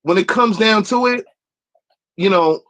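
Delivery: usual speaking voice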